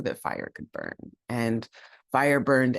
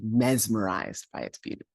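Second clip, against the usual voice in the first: ordinary delivery, nothing marked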